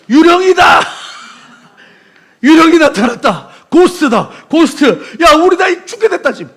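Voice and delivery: loud, high terrified yelling; panicked shout. Acted. Disciples on the boat screaming in terror at a ghost. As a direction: scream like men who think they saw a ghost.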